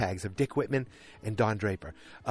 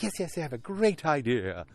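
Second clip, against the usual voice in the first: excited retelling with raised pitch; 6 semitones higher, 3 dB louder than usual. Retold or imitated. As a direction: act out his eager announcement, playfully. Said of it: "He was all excited".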